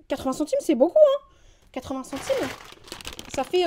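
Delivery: high, loud protest; outraged outburst over a small sum; indignant. Real, not acted. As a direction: push pitch and volume up in indignation. Outraged over being overcharged.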